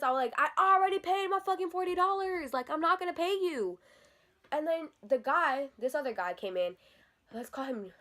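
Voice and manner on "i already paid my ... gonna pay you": high, exasperated retort; indignant protest. Retold or imitated. Teller replaying her refusal to pay twice, indignant. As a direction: argue back, pitched up and fed up. Retold argument over payment.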